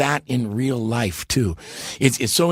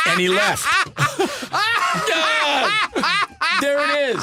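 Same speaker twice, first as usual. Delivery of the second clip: laughing. Real, not acted. Laughing at own story.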